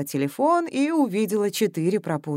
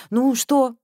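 higher, louder worried ask; old woman anxious for news; anxious question; 6 semitones higher, 6 dB louder than usual. A narrator's reading. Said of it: "взволнованно спросила".

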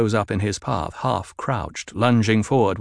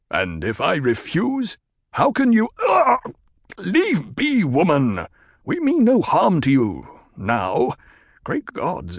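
strained Stilgar; 5 semitones higher than usual. Acted. Captive chieftain bargains with Jessica, strained. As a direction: bargain through the pain.

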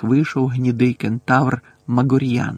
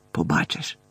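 barely audible; 8 semitones lower, 6 dB quieter than usual. A narrator's reading. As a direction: lower and much softer, almost to herself. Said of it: "ледь чутно відповіла вона".

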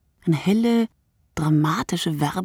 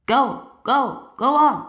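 loud, higher drill shout at running soldiers; sergeant urging on; 7 semitones higher, 5 dB louder, slower than usual. A narrator's reading. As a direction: bark them forward, sergeant. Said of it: "angefeuert von ihrem Sergeant".

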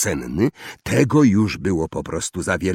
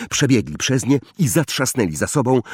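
ordinary delivery

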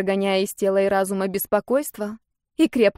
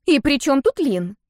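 higher-pitched, indignant query; indignant question; 5 semitones higher than usual. A narrator's reading. Younger sister asking what Lin has to do with it, indignant. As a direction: demand it with raised pitch. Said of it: "возмутилась Гвенни".

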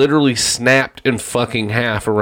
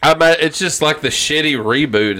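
ordinary talk, nothing marked

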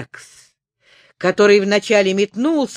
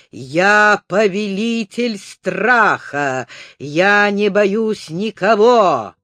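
triumphant exclamation; 3 dB louder than usual. Acted. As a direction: boast like a victorious ringmaster. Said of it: "торжествующе воскликнул".

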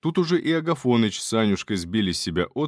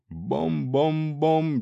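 slow, singsong bom-bom-bom; imitated clanging; 5 semitones higher, much slower than usual. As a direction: mimic the ladle blows. Ladle striking the head.

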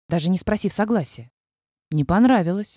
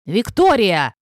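growling call; 5 dB louder, slower than usual. A narrator's reading. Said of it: "звучал как рык".

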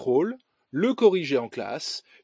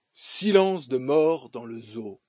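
ordinary talk

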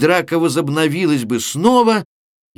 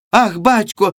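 put-on higher, louder girlish call; alarmed cry; 8 semitones higher, 4 dB louder than usual. A narrator's reading. Oksana running to her father, alarmed higher cry. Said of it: "произнесла Оксана".

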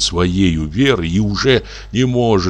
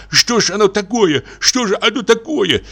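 frightened mumble; 14 semitones higher than usual. A narrator's reading. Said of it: "испугавшись, смутившись, забормотал".

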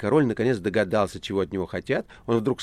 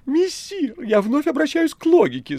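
very high, loud cat plea; theatrical talking cat appealing to reason; pleading cat Behemoth; 9 dB louder than usual. Acted. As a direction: implore in a squealing cat voice.

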